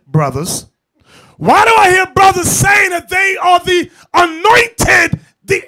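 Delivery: loud, high, fiery street-preacher shout; preaching at full force. Real, not acted. Street preacher shouting down the brothers, fiery full-force preaching. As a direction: hammer the brothers with every line.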